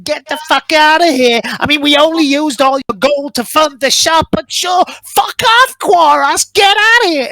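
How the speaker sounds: very high, squealed gnome rant; complaining gnome. Acted. Gnome sent off on his break, high squealing complaint. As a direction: rant shrilly as a furious little gnome. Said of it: "still complaining".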